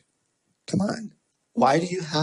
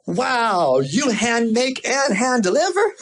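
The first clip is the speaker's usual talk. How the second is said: high, amazed, laughing question; high mock amazement with a laugh; amazed neighbor; 10 semitones higher, slower than usual. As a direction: marvel at it like the neighbor.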